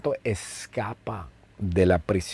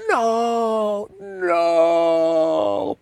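"¡No! ¡No!" mucho más agudo, grito fingido sin volumen; grito de Daniel; 8 semitones higher than usual. Retold or imitated. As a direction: imita a Daniel gritando alarmado, en broma. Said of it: "y Daniel grita". (grito fingido de Daniel, agudo)

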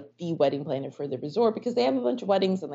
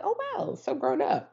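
mock impressed; 12 semitones higher than usual. Sarcastic reaction to hero.

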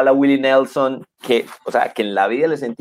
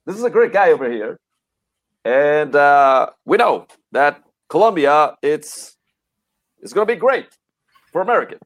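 Obama impression; 3 semitones higher than usual. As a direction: lightly do Obama's smooth English. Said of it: "Barack Obama decía".

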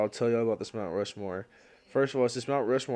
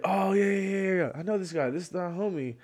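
higher-pitched, eager imitation of a friend; eager recognition; 7 semitones higher than usual. Friend vouching for a newcomer on stream, eager recognition. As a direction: act out a buddy keenly claiming an acquaintance. Acting out Jeffrey greeting a friend.

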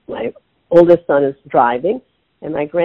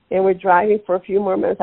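normal talking voice

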